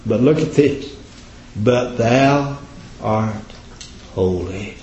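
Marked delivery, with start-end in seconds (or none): 1.6-4.7 sinking to a soft hush on holy; hushed reverence. Acted. Preacher quoting Christ's praise from the cross, soft and reverent. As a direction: let but thou art holy fall quiet and reverent.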